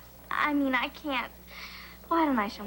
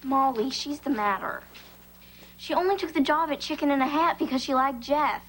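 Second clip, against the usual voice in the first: usual speaking voice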